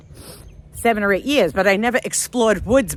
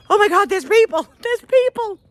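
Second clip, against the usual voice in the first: high-pitched thrilled outburst, retold; excited relief; 11 semitones higher, 4 dB louder than usual. Lost woman hears people, excited relief. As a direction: exclaim it high, re-enacting the thrill.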